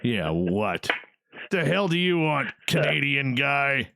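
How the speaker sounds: lightly imitating a grumpy guest; play-grouchy comeback, teasing the guest; a hint of put-on crankiness; mock cranky guest. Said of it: "I know you're all about".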